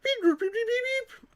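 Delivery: high-pitched droid beeps. Acted. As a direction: chirp like a little robot. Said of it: "I guess R two D two".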